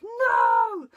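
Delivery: sudden loud high NO; sharp shout of no. Retold or imitated. Mum stopping a tot at a plug socket, shouting. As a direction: stop a toddler at the plug socket. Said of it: "if I shout no".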